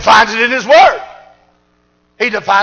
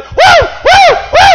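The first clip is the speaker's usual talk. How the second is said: put-on very high, loud girlish whoops; little girl's whoops; 6 dB louder, much slower than usual. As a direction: imitate a girl shouting for joy. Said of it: "I seen a little girl go".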